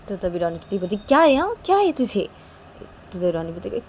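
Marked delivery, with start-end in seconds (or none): 1.0-2.3 higher-pitched sister snapping back; snappy sister. Acted. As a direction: throw it back at him, higher.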